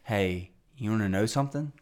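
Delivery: low, soft imitation of a whisper; confiding near-whisper. Man close to his ear offering a secret, hushed. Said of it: "like, kinda whispering to me".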